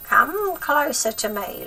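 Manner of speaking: slow, high coaxing crone; beckoning witch. A narrator's reading. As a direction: coax him closer, slow and high. Grandma beckoning George.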